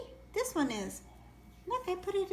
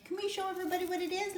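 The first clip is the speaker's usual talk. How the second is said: ordinary talk, nothing marked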